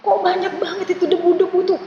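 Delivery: children's teacher acting startled at the approaching army. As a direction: gasp at the dust cloud in mock alarm. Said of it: "Kakak kaget".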